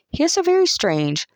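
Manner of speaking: high, affected lady's complaint; gossiping lady. Acted. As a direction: say it like a fussy snob. Camilla gossiping.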